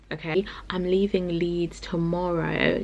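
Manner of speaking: slow unvoiced whisper; whispered announcement. Real, not acted. Telling viewers she leaves Leeds, whispered. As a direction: hush it like a secret. Sudden hushed aside.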